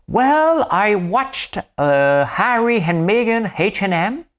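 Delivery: high, put-on English-speaking voice, slow at the end; English speaker impression. Host mimics an English speaker chatting, high and slow. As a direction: play an Englishman gossiping about royals in a squeaky voice. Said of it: "supposons qu'un Anglais parle".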